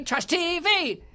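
high, slow silly repeat of trash T V; sing-song the phrase back; playful high echo; funny echo. Acted.

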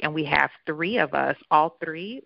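usual speaking voice

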